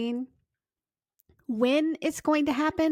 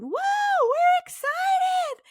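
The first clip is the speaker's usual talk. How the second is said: much higher, louder, slow drawn-out cheer; excited whoop; 6 dB louder, much slower than usual. Retold or imitated. Mom cheering for her daughter at camp registration, drawn-out whoop.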